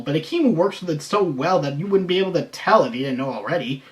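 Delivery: usual speaking voice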